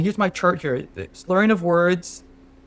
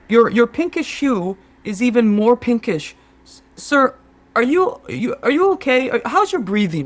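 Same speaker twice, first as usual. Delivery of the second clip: higher, louder, alarmed concern; a rising sense of alarm; 7 semitones higher, 3 dB louder than usual. Acted. Sherpa alarmed about climber's health.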